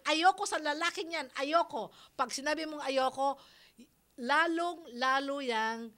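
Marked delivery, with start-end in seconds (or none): 0.1-1.9 loud, clipped parental no; mock angry refusal. Host mimicking a parent forbidding the boyfriend, loud mock anger. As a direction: bark the refusal like a strict parent. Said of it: "Huwag na huwag mo sasabihin".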